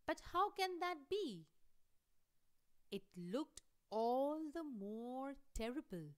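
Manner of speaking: mild confused surprise, kept going to the end; puzzled. Acted. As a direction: ask it in puzzled disbelief. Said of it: "Bahadur got puzzled, confused".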